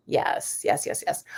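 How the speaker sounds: soft unvoiced whisper; whispering while reading chat; whispered yeses. Real, not acted. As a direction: whisper yes, yes to herself.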